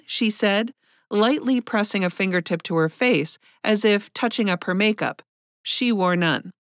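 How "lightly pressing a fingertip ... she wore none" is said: ordinary delivery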